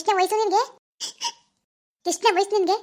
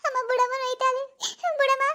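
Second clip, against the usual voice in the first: higher, pleading whimper; pleading cry; 6 semitones higher than usual. Acted. Daughter begging her mother to stop hitting, high and pleading.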